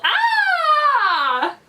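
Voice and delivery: high, loud, drawn-out scream; terrified yell. A narrator's reading. Father sees the scary painted face, terrified yell. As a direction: scream and let it slide down. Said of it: "his father yelled".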